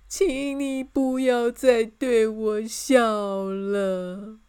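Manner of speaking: slow, loud, drawn-out whine; pleading. A narrator's reading. King begs her to stop smiling, pleading. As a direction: beg in a long tearful plea. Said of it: "国王就哀求她".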